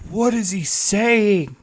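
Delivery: slow, high, puzzled mock question; baffled. Listener confused by a thick Scottish accent, baffled. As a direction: act out being lost by the accent. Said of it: "I was like".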